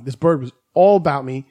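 ordinary delivery

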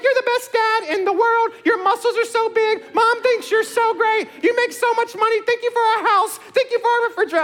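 put-on very high, sweet girl's voice; imitating daughter. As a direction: chirp like his adoring daughter. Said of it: "I was like".

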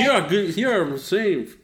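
talking through a mouthful of food; mouth full. Pretend. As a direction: mimic someone chewing as they speak. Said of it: "trying to mimic you with your mouth full".

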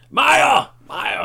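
high, loud mock yell across the yard; shouting a name. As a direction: imitate Olsen yelling for Meyer. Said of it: "Og så råber han". (efterlignet råb, højt og kraftigt)

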